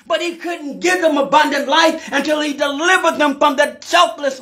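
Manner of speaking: high, sustained sermon chant; full-force preaching. Real, not acted. Preacher telling church he came to bring abundant life, high full-force preaching. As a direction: drive each phrase high and hard.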